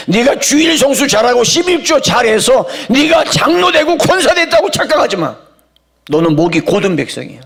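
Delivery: a stern divine rebuke that climbs in pitch; God speaking to me. Acted. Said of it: "하나님이 나에게 말씀하세요".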